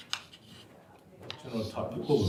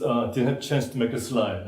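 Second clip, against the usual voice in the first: ordinary talk, nothing marked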